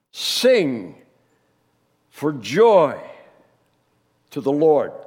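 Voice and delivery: slow, loud, ringing proclamation; exuberant proclamation. Shown as a demonstration. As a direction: proclaim it with full joy. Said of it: "highest of praise to God".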